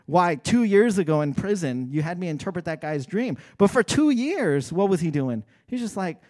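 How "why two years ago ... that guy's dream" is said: normal talking voice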